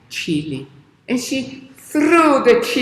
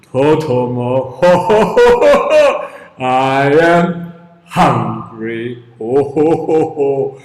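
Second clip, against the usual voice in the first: hungry giant; 8 semitones lower, 6 dB louder than usual. Acted. Giant threatening to eat Totomo, deep booming voice.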